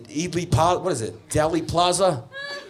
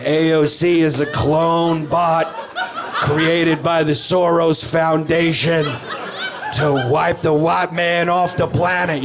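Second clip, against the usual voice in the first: slow, louder, earnest crank voice; mock conspiracy nut; 4 semitones higher, 5 dB louder, slower than usual. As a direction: lay out the theory like gospel. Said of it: "They're like".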